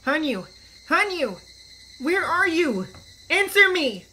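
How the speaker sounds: loud, urgent shouts into the dark; frantic calling. Acted. Rika calling out to Hanyu she cannot see, loud and urgent. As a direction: call out desperately for a lost friend.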